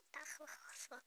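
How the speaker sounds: breathy whispered echo. Shown as a demonstration. Reader echoing the text, soft whisper. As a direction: repeat the word under your breath. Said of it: "whispered echo of word".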